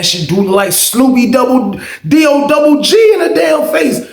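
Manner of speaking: rising, emphatic spelling-out; incredulous outburst. Retold or imitated. Teller's silent thought on the portrait, incredulous outburst. Inner reaction spelling out the name.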